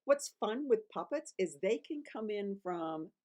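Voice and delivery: normal talking voice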